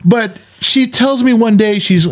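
laughing. Real, not acted. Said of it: "laughs while talking".

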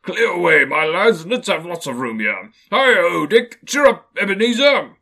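loud, high, booming jovial shout; rally the lads like a merry old boss; jolly Fezziwig call. Acted. Fezziwig orders his apprentices to clear the room, loud and jolly. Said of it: "cried old Fezziwig".

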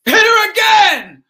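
loud, high, slow shout; loud challenge. Retold or imitated. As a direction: goad them on boldly. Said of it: "and I said".

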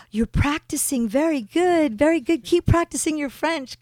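high, lively praise, retold; encouraging French gentleman. French money changer praising a customer's French, high and encouraging. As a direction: imitate an encouraging money changer, pitched well up. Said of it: "the gentleman ... he's like".

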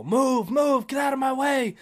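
high, loud mock yell; retold yell. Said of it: "I'm yelling at people".

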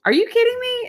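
indignant guest teasing her host; incredulous. Retold or imitated. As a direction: squeal out the disbelief. Morning disbelief at the host.

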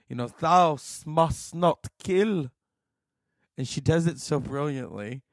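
ordinary talk, nothing marked

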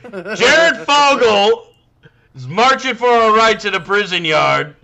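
high, loud mock whiny protester. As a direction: whine it like an aggrieved activist. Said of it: "And it's like".

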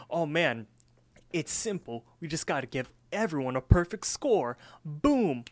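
excited brainwave. Retold or imitated. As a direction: pitch the idea like a cartoon slacker's eureka. Rigby excitedly revealing his idea.

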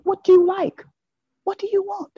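a woman keen to please her man, voiced high; eager woman. Desiring woman asking her man what he wants, high and eager. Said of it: "A woman that is desiring a man".

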